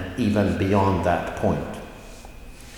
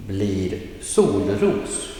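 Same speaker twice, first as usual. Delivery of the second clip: slow "blir solros", falling on sol and rising on ros; combined-word tone; much slower than usual. Shown as a demonstration. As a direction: model "solros" as one compound, dip then lift. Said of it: "a combined word".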